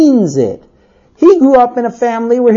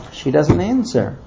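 usual speaking voice

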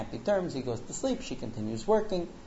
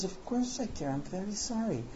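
higher, softer apologetic voice; soft apology; 4 semitones higher, 10 dB quieter than usual. Retold or imitated. Wife answering her hurt husband, soft apology. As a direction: plead softly for forgiveness.

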